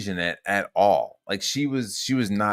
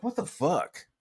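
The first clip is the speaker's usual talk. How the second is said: high-pitched, softer incredulous reaction; retold disbelief; 10 semitones higher, 5 dB quieter than usual. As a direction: squeak out mock bafflement. Retelling own baffled reaction.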